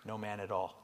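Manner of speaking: muttered quietly aside; hushed throwaway punchline; under his breath. Acted. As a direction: drop it to a soft joking aside. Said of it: "said under his breath".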